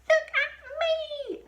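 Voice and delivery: put-on high, loud, slow old woman's yell; Grandma shouting. A narrator's reading. Grandma shouting from the rooftop for attention. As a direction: demand attention from the roof. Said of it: "Grandma shouted from the rooftop".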